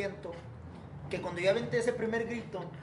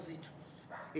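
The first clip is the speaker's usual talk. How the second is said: imitated footsteps; 3 semitones lower, 16 dB quieter, much slower than usual. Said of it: "escucho así como que pasos".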